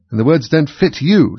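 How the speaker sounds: high, loud, smug king. A narrator's reading. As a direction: say it with a smug smile. King smiling.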